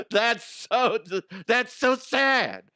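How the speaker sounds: high, slow, pitying sneer; mocking diva ghost. Ghost mocking the naked person in the mirror, high slow pity. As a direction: drag it out like a catty ghost.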